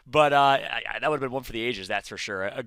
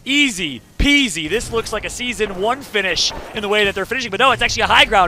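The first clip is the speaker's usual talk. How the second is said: loud, raised, excited match call; live esports play-by-play; 6 semitones higher, 10 dB louder than usual. Real, not acted. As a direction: call the fight at full force.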